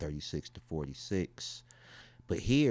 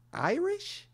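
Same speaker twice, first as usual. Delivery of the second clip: very high rising falsetto question; high-pitched disbelief at being Irish. Real, not acted. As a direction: squeak it up high, can't believe it.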